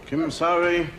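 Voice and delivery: low, monotone stock Indian voice; low, stilted stage Indian speech; mock stage Indian sidekick. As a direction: play the subservient Indian sidekick, low and level. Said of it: "I'm tired of talking like an Indian".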